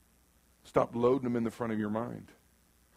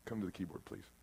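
much softer, hushed request to the keyboard player; quiet aside; 6 semitones lower, 16 dB quieter than usual. Real, not acted. Preacher quietly calling the keyboardist up, hushed. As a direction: murmur it off to the side.

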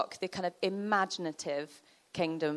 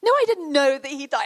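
high, loud mock outrage; hammed-up angry protest; furious; 7 semitones higher, 8 dB louder than usual. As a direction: burst out in acted indignation. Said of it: "And so he was furious".

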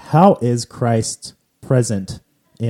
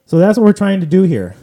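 higher and louder, an eager emphatic push; emphatic summing up; 9 semitones higher, 8 dB louder than usual. Real, not acted. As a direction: say it like the big point of the episode. Host sums up podcast purpose.

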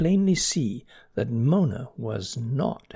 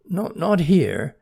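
faltering; 4 semitones higher, 5 dB louder, slower than usual. Acted. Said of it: "faltered Bernie".

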